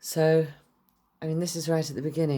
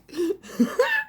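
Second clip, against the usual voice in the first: giddy laugh. Real, not acted. Giddy after excited whisper.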